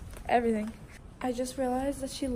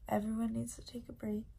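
whispering; 10 dB quieter, faster than usual. Real, not acted. Whispered aside on floor.

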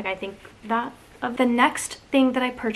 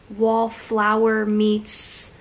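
usual speaking voice

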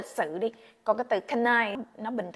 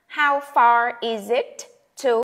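slow model line; 5 dB louder, slower than usual. Shown as a demonstration. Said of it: "Cộng cho cái danh từ đó".

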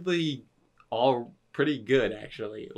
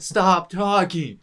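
slower, louder, higher fed-up plea; mock exasperated begging, slow and louder; exasperated repeat; 6 semitones higher, 7 dB louder, much slower than usual. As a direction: stretch it out and lean in, begging them to stop. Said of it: "and it was just like".

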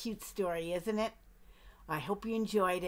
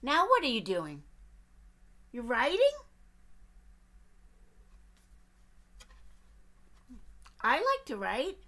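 eager duck; 3 semitones higher, 4 dB louder than usual. Acted. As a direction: pester the bear as a nosy duck. Duck's voice.

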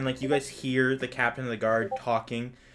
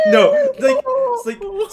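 startled high cry; 10 dB louder, slower than usual. Real, not acted.

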